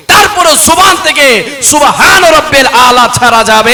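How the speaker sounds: loud, high, ringing declamation; impassioned, shouted sermon climax; full-force preaching. Real, not acted. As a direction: hammer each phrase out to the crowd.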